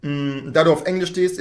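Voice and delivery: usual speaking voice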